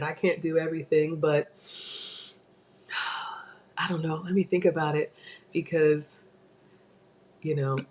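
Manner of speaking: ordinary delivery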